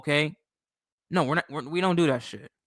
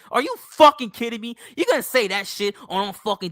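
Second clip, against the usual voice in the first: real outrage; 6 semitones higher, faster than usual. Real, not acted. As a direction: fire it off in angry disbelief. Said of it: "Shit pisses me the fuck off".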